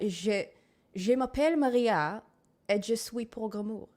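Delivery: put-on self-introduction in a French accent; fake French accent. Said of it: "I can fake a nice French accent".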